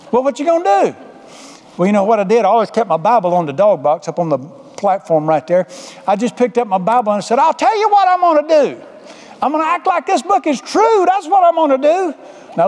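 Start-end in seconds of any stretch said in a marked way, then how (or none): 0.1-0.9 high, drawn-up taunting question; insistent demand. Acted. Tires press the driver for an answer, high and insistent. 7.4-12.1 high, emphatic retort; defiant declaration. Acted. Driver answers worry with Bible in hand, high and defiant.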